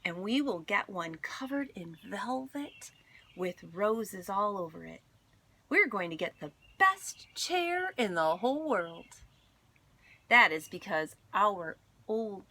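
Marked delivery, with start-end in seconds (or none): none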